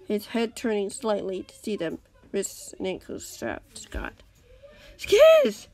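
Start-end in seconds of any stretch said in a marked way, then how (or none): 5.0-5.7 high loud cry of a name; sudden shout of recognition. A narrator's reading. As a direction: call out to a found friend. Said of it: "Impulse cried".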